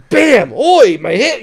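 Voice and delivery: loud, high mock yelp of pain; over-the-top comedic. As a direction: ham it up like slapstick. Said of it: "something hilariously over the top".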